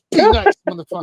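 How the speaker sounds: put-on high, loud girlfriend voice hissing a warning. Imitating the girlfriend off camera, high and loud. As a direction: play her scolding him under her breath. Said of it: "she's like".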